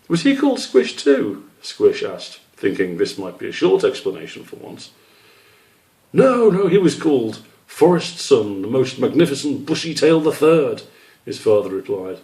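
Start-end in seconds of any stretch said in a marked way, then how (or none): 0.1-1.4 much higher, eager little question; a curious child asking his dad; young squirrel voice. Acted. Squish asking his father about his name, high and eager. 6.1-10.8 a hint of a grand father, higher and louder; father savouring the grand name; lightly voiced proud father. Father telling Squish about his grandfather, hammed-up pride.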